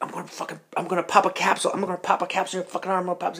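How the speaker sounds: high-pitched ranting imitation; hammed-up threatening babble; mock threat. Retold threat during arm bar.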